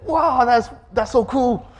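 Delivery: high, amazed crowd voice; impressed onlookers. Retold or imitated. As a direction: gush like dazzled spectators. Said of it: "and they'll be like".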